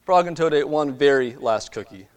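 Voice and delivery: lively storybook reading; reading to children. Acted. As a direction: read it like a bedtime picture book. Reads storybook to congregation.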